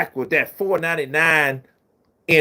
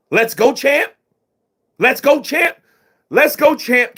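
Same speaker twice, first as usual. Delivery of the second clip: Shannon catchphrase; 9 semitones higher than usual. Retold or imitated. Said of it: "that means something".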